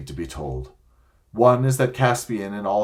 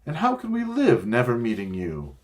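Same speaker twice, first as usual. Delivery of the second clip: put-on sobbing girl; 7 semitones higher than usual. A narrator's reading. Lucy weeping that she will not meet Aslan, sobbing. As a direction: plead through tears, much higher. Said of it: "sobbed Lucy".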